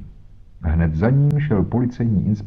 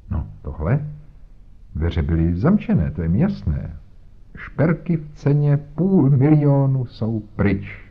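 higher, self-satisfied bluster; pompous inspector; 5 semitones higher than usual. Acted. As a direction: lecture Holmes with condescension. Voiced inspector Jones talking. (nafoukaný inspektor, výš posazený hlas)

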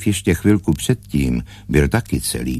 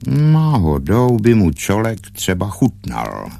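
loud, higher-pitched butting in; opinionated grebe; 6 semitones higher, 5 dB louder than usual. A narrator's reading. Water bird backs the stork's idea, loudly. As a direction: chime in boldly.

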